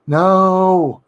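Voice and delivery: loud, high cry of pain; anguished cry. A narrator's reading. Possessed girl cries out in pain inside the darkness, anguished cry. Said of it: "I cried again, racked with pain".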